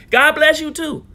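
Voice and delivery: high, loud God bless you too; loud defiant blessing. Real, not acted. Answers a hostile commenter.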